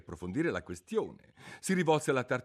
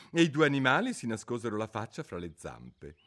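ordinary delivery, nothing marked

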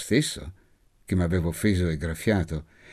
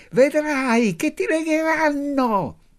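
put-on very high, slow, spiteful child's shout; taunting little girl; 4 dB louder, slower than usual. A narrator's reading. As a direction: sneer it from the doorway with a grimace. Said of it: "farmi una brutta smorfia e gridarmi". (bambina dispettosa che grida, lenta e acutissima)

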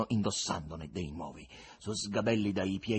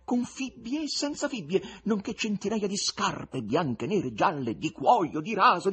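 narration climbing higher and louder through the list; mounting wonder; 10 semitones higher, 4 dB louder than usual. A narrator's reading. Narrator dazzling listeners with the shop's wonders, building excitement. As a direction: pile up the marvels with growing excitement.

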